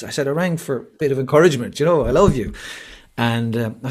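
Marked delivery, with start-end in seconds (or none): 0.3-2.5 higher, louder, comically aggrieved; retold complaint, swooping up in playful exasperation; mock pleading protest. Nervous husband begs wife for encouragement, mock pleading protest.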